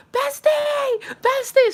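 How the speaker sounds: high-pitched retold scream, calling out; screaming for help. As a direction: scream to catch a friend's attention.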